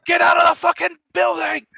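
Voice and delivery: high-pitched scream from a crashed car; acted high holler. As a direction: yell it out like someone trapped in wreckage. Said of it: "I'm still screaming from, like, the crashed car".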